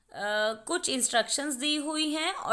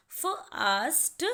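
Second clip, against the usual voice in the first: "Fast" drawn out very slowly so every letter is heard; slow demo word; much slower than usual. Shown as a demonstration. Teacher to students letting every letter be heard, slow. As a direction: stretch "fast" so each sound comes through. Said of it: "letter की sound आप सुन सकते हैं".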